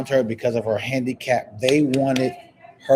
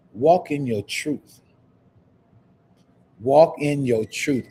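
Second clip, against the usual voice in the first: preaching; slower than usual. Real, not acted. Preaching slow uplifting message to viewers.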